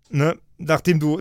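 usual speaking voice

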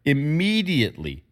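immediately repeated with firm emphasis; stress on immediately. Real, not acted. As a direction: hit the word immediately deliberately. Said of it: "I emphasize that word".

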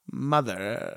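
very low, soft haunting voice; a creepy dog voice; creepy ghost child voice. Pretend. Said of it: "that's what he's saying to Tiffany is".

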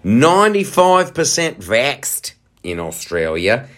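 hammed-up Australian accent; put-on Australian accent. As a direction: play a comic Aussie, broad vowels. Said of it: "do the Australian thing".

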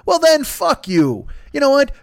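normal talking voice

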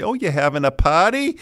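a high Boston mom voice; mother's Boston accent. Retold or imitated. Kids hear grandmother on the phone, high Boston accent. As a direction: call like a Boston mother checking in. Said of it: "they hear it when my mother calls".

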